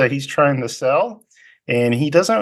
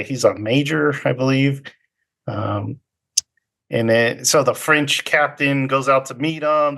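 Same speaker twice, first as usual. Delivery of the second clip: ordinary talk, nothing marked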